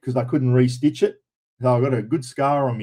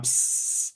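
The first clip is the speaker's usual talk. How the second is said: soft unvoiced hiss like a spray can; imitated spray hiss; 10 dB quieter than usual. Imitating the doctor's liquid nitrogen gun, a soft hiss. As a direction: mimic the nitrogen gun's hiss. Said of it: "He sits there going".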